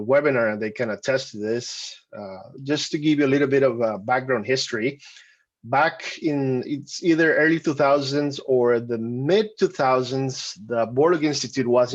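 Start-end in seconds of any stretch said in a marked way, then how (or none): none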